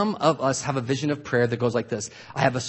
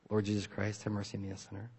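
much softer, murmured devout prayer; hushed prayer; 5 semitones lower, 12 dB quieter than usual. Shown as a demonstration. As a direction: breathe the prayer quietly. Demonstrating prayer quietly.

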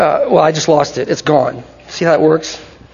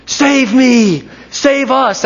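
higher, insistent acted cry; crowd's plea; 5 semitones higher, much slower than usual. Crowd running towards the Messiah, urgent plea. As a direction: plead as a crowd crying out for rescue. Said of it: "They're saying".

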